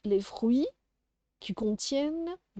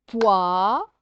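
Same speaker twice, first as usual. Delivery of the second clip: "Poire" split into loud rising "poi" and separate "re"; syllable by syllable; 7 dB louder than usual. Shown as a demonstration. Teacher sounding out syllables for a child, "Poire" split in two. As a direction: stretch "Poire" in two beats with a pause. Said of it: "de faire les syllabes".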